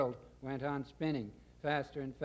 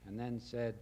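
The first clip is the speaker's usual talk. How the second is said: ordinary delivery, nothing marked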